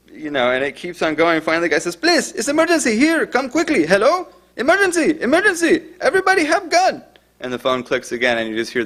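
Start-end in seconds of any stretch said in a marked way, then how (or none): 2.0-7.0 put-on very high, panicky Spanish-accented pleading; imitated desperate caller. Caller begs dispatcher amid guns, imitated panic. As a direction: beg for help, pitch way up throughout.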